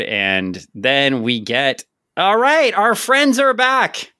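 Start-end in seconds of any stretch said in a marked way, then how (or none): 2.2-4.1 excited. Real, not acted. Host thrilled the villains are back in the comic, excited.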